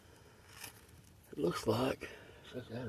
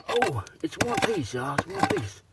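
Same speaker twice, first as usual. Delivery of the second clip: excited; 6 semitones higher, 4 dB louder than usual. Real, not acted. Excited find of a gemstone.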